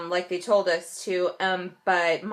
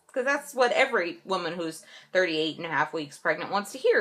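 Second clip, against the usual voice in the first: normal talking voice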